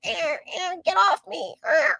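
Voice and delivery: be a chair begging to be let go; squealing chair. Pretend. Voicing a crushed chair begging for help in a high squeak. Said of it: "chair was fucking screaming for help".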